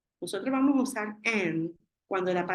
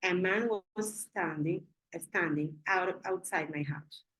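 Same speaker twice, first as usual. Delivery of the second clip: slow, careful sentence for the class; slow dictation; slower than usual. Shown as a demonstration. Teacher reading a sample sentence slowly to students. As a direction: spell out each word slowly like dictation. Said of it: "Les digo".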